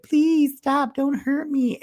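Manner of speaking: high squeaky pleading; mock high plea. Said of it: "She's like".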